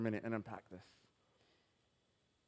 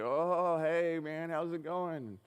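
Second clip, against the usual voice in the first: high, drawn-out mock surprise; startled greeting; 4 semitones higher, 3 dB louder, much slower than usual. Man suddenly recognizing the speaker, startled and overfriendly. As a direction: imitate someone caught off guard, starting loud and high. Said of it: "his eyes got really big".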